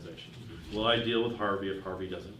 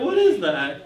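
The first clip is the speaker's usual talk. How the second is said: incredulous, laughing question, pitched way up; laughing disbelief; 10 semitones higher, 11 dB louder, slower than usual. Retold or imitated. Retelling the absurd wall sign, laughing in disbelief. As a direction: blurt it out high and loud through a laugh. Team baffled by the cafeteria sign.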